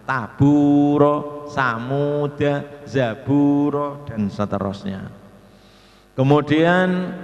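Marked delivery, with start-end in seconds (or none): 0.1-4.1 slow chant-like "Taburo, samuda, zaburo" with long middle vowels; contoh bacaan panjang. Shown as a demonstration. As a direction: stretch the u in each word. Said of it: "guru harus selalu waspada". ("Taburo, samuda, zaburo" dibaca pelan dengan u panjang)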